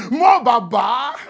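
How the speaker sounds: loud, high and slow praise chant; mock crowd cheering Moses; crowd shouting praise. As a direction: hail him like a cheering crowd. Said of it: "people just suddenly shouting".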